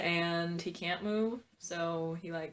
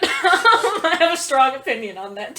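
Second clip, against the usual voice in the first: laughing; 5 semitones higher, 11 dB louder, slower than usual. Real, not acted. Laughing while insisting.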